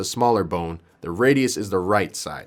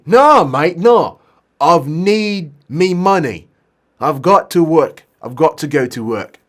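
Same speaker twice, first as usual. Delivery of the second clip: louder, higher, slow British protest; British accent; 5 semitones higher, 7 dB louder, slower than usual. Acted. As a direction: dig in as a stubborn British patient. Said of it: "maybe he is British".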